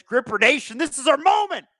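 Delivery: high, hyped-up rally call; rallying cry. Acted. Streamer reads a fan's message to the audience, hyped rallying cry. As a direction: crow it out to a whole fan nation.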